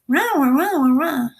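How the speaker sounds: high, wordless wah-wah drone; cartoon teacher. Shown as a demonstration. Trainer ends a rambling demo as cartoon teacher, wah-wah drone. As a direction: swoop up high in meaningless muffled syllables. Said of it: "Remember Charlie Brown? ... The teacher".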